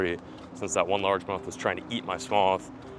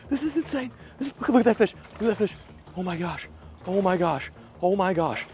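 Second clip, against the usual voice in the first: much higher, breathless amazement; can't believe your eyes; real giddy disbelief, higher and a little louder; stunned excitement; 12 semitones higher, 4 dB louder than usual. Real, not acted. Still watching the huge bass.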